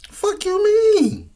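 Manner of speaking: very high, slow, drawn-out squeak; squeaky mock voice. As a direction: stretch it out in a squeaky falsetto. Repeated retort, mock high take.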